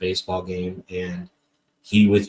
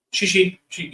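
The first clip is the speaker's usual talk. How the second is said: playful xi xi; 9 semitones higher than usual. Retold or imitated. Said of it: "we say it to each other".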